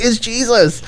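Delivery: high-pitched exclamation of discovery; re-enacted moment of joyful realization; awestruck revelation. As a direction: cry it out in high wonder. Repeats the tearful revelation cry.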